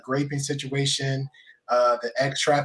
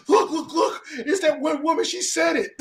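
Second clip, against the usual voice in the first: high, giddy tattletale voice; excited gossip; 13 semitones higher than usual. Retold or imitated. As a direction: squeal it like gossip. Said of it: "I could hear somebody clip it now. Like".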